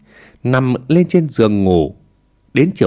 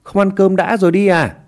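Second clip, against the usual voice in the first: high call after someone leaving; mother calling after her son, raised voice; 7 semitones higher than usual. A narrator's reading. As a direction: call out to him as he goes. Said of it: "Mẹ hắn gọi với theo".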